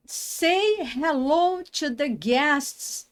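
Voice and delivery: drawn-out teacher read; slow model sentence. Shown as a demonstration. Teacher dictates the English example slowly. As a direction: spell it out slowly for learners. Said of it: "Então, vamos lá".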